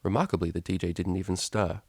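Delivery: usual speaking voice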